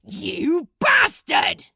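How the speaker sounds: furious outburst. Acted. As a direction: spit it out in sudden rage. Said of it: "Anger flooded Crowley".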